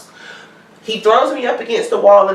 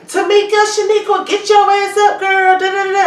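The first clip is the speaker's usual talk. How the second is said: hollering names up at the house like a rowdy cop; mock police yelling; 12 semitones higher than usual.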